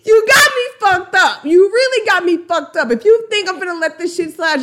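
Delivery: loud, high-pitched mock fury; outraged rant. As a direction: burst out indignant, then speed up. Acting out an outraged threat.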